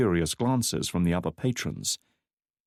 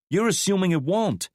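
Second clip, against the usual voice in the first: higher woman's voice, exasperated; a worried woman pushing back; McKnight's voice; 12 semitones higher, 4 dB louder than usual. A narrator's reading. McKnight confronting Drake over his plan, higher.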